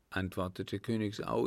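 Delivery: ordinary delivery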